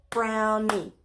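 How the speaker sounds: brownie said in two beats, BROW-NIE; clapped syllables. Shown as a demonstration. Teacher to kids, clapping out the syllables. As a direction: split brownie into its two claps. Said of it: "clap it while our mouth says it".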